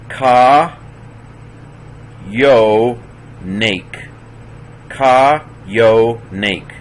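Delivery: "Ka, yo, nake" with a Y sound starting "yo"; genuine yod. Shown as a demonstration. Teacher showing the yod, "yo" said with real Y. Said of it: "that's a genuine yod there".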